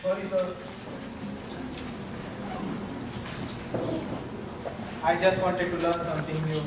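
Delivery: low, soft, dejected; sad and quiet. Acted. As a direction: say it hurt and small. Servant sad after scolding.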